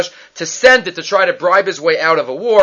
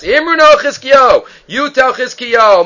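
higher, louder mock taunting shout; taunting yell; 6 semitones higher, 5 dB louder, slower than usual. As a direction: bellow it at the besieged city like an enemy herald. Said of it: "And he starts screaming at them".

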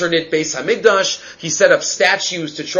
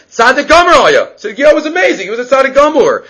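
much higher, louder exclamation; excited; 8 semitones higher, 4 dB louder than usual. Real, not acted. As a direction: burst out in admiration. Exclaims Tzidkiyo was amazing.

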